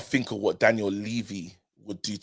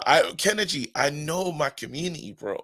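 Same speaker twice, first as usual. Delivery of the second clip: usual speaking voice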